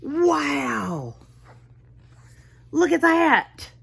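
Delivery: high amazed gasp of wonder. Real, not acted. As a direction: wow them with surprise. Teacher reacting to picture.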